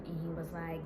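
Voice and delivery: ordinary delivery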